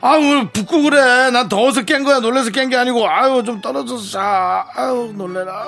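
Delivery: high, irritated husband voice; annoyed husband. Acted. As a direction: snap at the clingy wife. (높은 목소리로 짜증 내는 남편)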